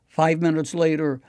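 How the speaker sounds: ordinary delivery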